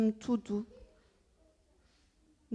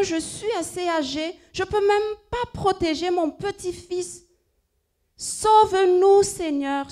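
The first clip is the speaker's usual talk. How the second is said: higher, louder pleading prayer; 5 semitones higher, 3 dB louder than usual. Acted. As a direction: cry out to God for rescue. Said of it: "finit la prière en voix haute".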